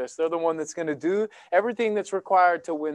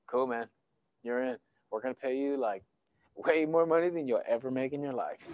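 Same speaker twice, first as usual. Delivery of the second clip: casual team manager; 6 semitones lower, 9 dB quieter than usual. Retold or imitated. Team manager casually accepts him.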